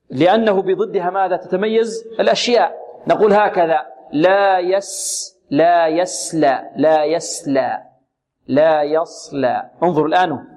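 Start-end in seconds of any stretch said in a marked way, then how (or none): 4.1-7.8 slow لا يسلى with a thin, light س; sin for contrast. Shown as a demonstration. Tajweed teacher trying the opposite, thin س in يسلى. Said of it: "نجرب ونأتي بالسين". 8.5-9.7 slow لا يصلى with a full, dark ص; heavy sad. Shown as a demonstration. Tajweed teacher revealing the contrast, heavy ص in يصلى. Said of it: "وضحت الآن الصاد".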